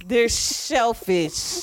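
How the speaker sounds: drawn-out, flat punchline echo; slow deadpan repeat. Shown as a demonstration. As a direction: say it slowly like you are unimpressed. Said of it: "repeats punchline".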